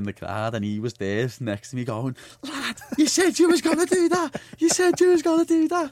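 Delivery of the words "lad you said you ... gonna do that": very high, loud screech of a thrilled mate; mimicked high-pitched shouting in disbelief; imitated excited yelling. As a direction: shriek it like a mate in the crowd. Mate's yelling in the crowd retold.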